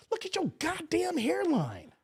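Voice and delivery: drawn-out high scornful retort; mocking retort. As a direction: slowly rub it in, mocking. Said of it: "so many arguments with people".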